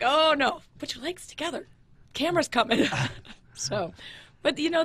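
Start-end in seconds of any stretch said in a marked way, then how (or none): 0.0-2.9 higher, louder urgent warning. Retold or imitated. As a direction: hurry him before the camera. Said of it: "I was just like".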